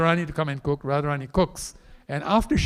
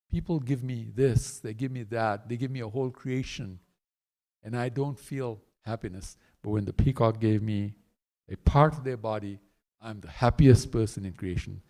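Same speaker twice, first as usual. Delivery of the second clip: low, hushed, moved musing; overwhelmed Krishna; 7 semitones lower, 4 dB quieter than usual. Acted. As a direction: think aloud softly, deeply touched. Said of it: "Krishna became totally overwhelmed".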